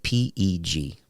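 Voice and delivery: slow, low letter-by-letter reading; spelled out slowly. Retold or imitated. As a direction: read each letter out slowly. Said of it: "like that".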